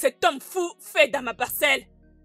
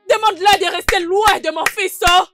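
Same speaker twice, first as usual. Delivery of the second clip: louder, higher insistence; angry demand; 4 semitones higher, 7 dB louder than usual. Acted. Mother warning a father about her son, angry demand. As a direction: hammer the demand in a raised voice.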